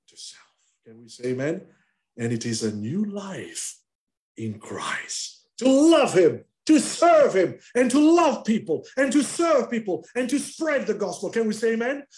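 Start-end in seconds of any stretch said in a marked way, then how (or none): 5.6-12.0 loud, high rhythmic build; preaching at full force. Acted. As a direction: drive each phrase home to the congregation.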